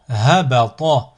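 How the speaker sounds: syllables joined as one word. Shown as a demonstration. Teacher models a word for learners to repeat, joined in one go. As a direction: run the syllables together without pauses. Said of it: "ensuite le mot".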